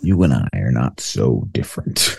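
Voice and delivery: low, slow supervillain purr; slow theatrical villain menace. Acted. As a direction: menace them like a comic-book villain. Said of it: "Green Goblin".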